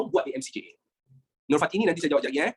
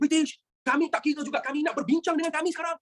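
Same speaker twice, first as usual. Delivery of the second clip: mock Putra demand; 7 semitones higher than usual. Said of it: "Putra kata macam tu".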